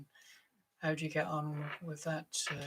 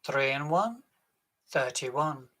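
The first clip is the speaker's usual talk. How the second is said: loud, drawn-out bingo calls; bingo caller; 9 dB louder, slower than usual. Acted. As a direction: call the numbers across the hall. Bingo caller calling numbers.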